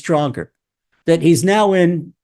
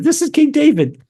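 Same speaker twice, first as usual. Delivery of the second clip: ordinary delivery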